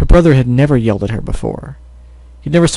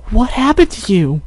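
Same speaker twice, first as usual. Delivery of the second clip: put-on much higher child voice, concerned; a much higher, anxious little girl; worried little sister; 10 semitones higher than usual.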